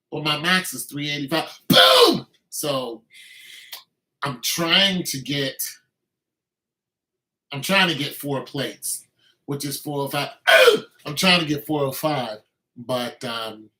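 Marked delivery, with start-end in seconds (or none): 1.7-2.3 loud high shout of triumph; excited boom. Real, not acted. Celebrating his max. 10.5-10.8 loud high whoop; excited ooh. Real, not acted. Hyped about four plates.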